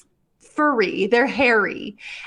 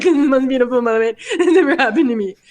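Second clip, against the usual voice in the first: hammed-up weepy gushing, fast; mock sobbing; 3 semitones higher, 3 dB louder, faster than usual. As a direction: imitate blubbering with joy, laughing through it. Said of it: "I was literally sobbing the entire time".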